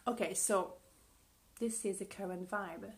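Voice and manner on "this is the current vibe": ordinary talk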